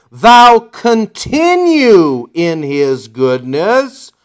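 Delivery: preaching the warning slowly; emphatic slow reading. Shown as a demonstration. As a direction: lean hard on every word, slow and deliberate. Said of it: "why am I emphasizing also".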